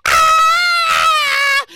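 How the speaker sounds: loud, high triumphant yell; excited scream. Pretend. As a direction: shout in celebration. Celebratory scream.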